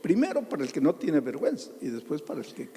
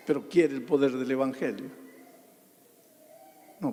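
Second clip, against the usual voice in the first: usual speaking voice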